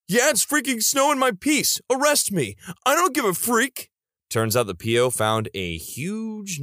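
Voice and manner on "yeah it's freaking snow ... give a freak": high-pitched mock outburst; hammed-up indignant rant, pitched way up; upset. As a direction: imitate a worked-up, defiant man. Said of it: "got upset and said".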